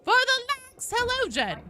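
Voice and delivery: high, shrill granny battle cry; old lady voice. Acted. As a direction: cheer like a spry old lady. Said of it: "supposed to be doing the old la-, lady voice".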